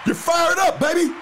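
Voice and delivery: pumped shout to his friend; hyped up. Real, not acted. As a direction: cheer him on.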